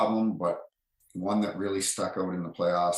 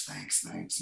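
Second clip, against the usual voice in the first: much lower, softer, mumbled thanks; muttered thanks; 11 semitones lower, 8 dB quieter than usual. Retold or imitated. Avery thanking the ref under his breath, low and mumbled. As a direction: toss off a low quick thanks.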